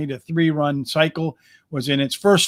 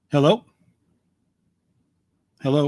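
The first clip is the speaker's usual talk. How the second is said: ordinary delivery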